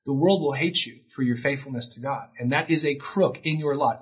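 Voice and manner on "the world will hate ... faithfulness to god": usual speaking voice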